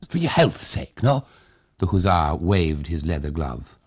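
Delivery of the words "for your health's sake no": put-on high, fussing old man's voice; a fussing host pressing hospitality; old Tag, eager host. A narrator's reading. Old Tag urges the hussars inside, high and eager.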